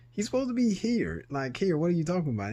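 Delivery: retold disbelief. Son arguing with officer about his father, disbelieving. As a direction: play his shock at the officer's news. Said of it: "And I'm like".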